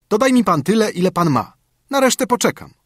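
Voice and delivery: put-on high, carefree young son; cheerful son. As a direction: breezily shrug off the debt. Son bargaining with goldsmith.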